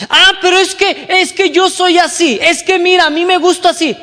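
high, loud mocking whine; whiny excuse. Preacher parodies a believer who says she's just like that, high whine. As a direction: parody a stubborn churchgoer.

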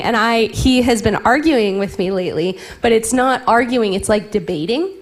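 usual speaking voice

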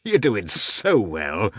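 high-pitched, gushing gentleman; exuberant Oblonsky. Acted. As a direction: bubble over with joyous encouragement. Voices Oblonsky in dialogue.

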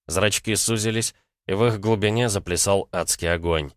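usual speaking voice

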